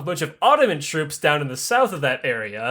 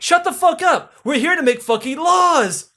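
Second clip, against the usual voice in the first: much higher, louder mock yell from Catherine; exasperated yell; 10 semitones higher, 4 dB louder than usual. Catherine yells at noble asking for titles, higher exasperated mock yell. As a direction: blow up at them, pitch jumping high.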